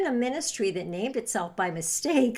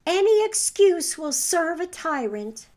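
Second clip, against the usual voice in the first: dying gasp, higher; 7 semitones higher, 6 dB louder than usual. A narrator's reading. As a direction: gasp it out with last breath. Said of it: "she gasped out".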